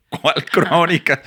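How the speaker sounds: slow, high, puzzled "¿Cuál crónica?"; high, stretched-out bewildered question; baffled question. Retold or imitated. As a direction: drag it out, totally lost. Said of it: "Y yo".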